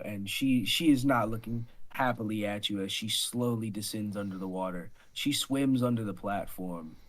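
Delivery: low, soft game master narration; ominous scene setting. Acted. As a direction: narrate the lurking threat quietly. Game master narrates a lurking monster.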